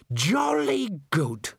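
slow, fussy theatre manager voice; slow and self-important; pompous manager. A narrator's reading.

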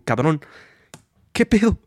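exasperated shout. Retold or imitated. Voiced exasperated exclamation.

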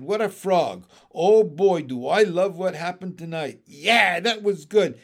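loud, gleeful frog; boisterous raspy bullfrog. A narrator's reading. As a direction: crow with delight in a raspy frog voice. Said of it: "in his raspy voice".

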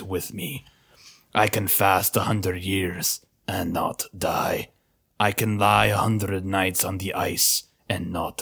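slow, low rasping beast voice; werewolf voice. Pretend. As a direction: creep it out like a starving wolf. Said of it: "Give me your good werewolf voice".

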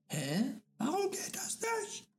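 high puzzled creature squeak; baffled little monster voice; confused creature. Acted.

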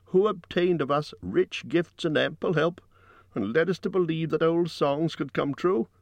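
high-pitched rhetorical questioning; loud and clear. A narrator's reading. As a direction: declaim each question high to the crowd. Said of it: "spoke very loud and clear".

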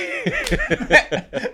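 rire aigu qui éclate puis retombe; grand éclat de rire. Real, not acted. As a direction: laisse partir un gros rire. (éclat de rire aigu)

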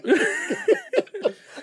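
high-pitched laughter through the words; cracking up mid-sentence; laughing hard. Real, not acted. All three laughing hard.

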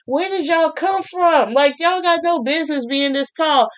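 put-on amazed at tall girls. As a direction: stretch it out high, staring up at them. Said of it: "and I'm just like".

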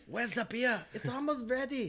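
put-on high, hammy German accent; mock German accent, laughing; bad German accent. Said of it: "probably the worst German accent".

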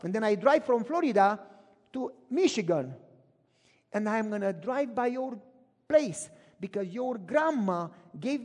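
loud, eager truck driver calling; calling out loud to a friend; booming driver on the phone; loud retold call.

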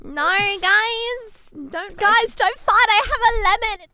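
very high, loud, silly voice; piercingly high mock peacemaker, words stretched out; annoying put-on accent. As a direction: squeal it in an exaggerated accent. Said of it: "Most annoying accent".

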